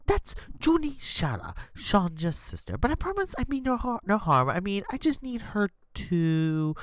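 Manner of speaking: high, anxious villain's plea; Prodigal pleading. Acted. GM voices Prodigal explaining.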